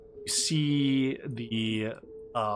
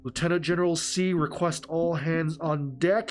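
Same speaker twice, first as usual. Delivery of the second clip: military announcement; 3 semitones higher than usual. Acted. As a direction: announce the order to all hands. Soldier relays order as announcement.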